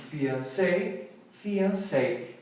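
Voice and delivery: fiancé and fiancée said identically, fee-ahn-SAY; same fiancé. Shown as a demonstration. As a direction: say both words exactly alike. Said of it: "Fala igualzinho". (fiancé e fiancée pronunciados igualzinho)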